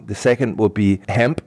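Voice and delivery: usual speaking voice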